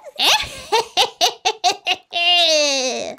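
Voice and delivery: long, rapid crazy cackle; acted crazed laugh. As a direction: cackle on and on like a madwoman. Voices the crazy double after introducing it.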